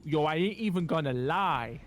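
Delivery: a rough, slow stab at Cockney; a loose London Cockney impression; Cockney accent. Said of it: "Can't do a Cockney accent very well".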